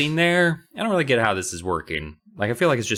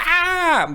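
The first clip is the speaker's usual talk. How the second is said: sharp high scream; 3 dB louder than usual. Acted. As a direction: shriek at the drop. Ride scare scream.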